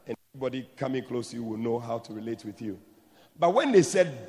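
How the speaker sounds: usual speaking voice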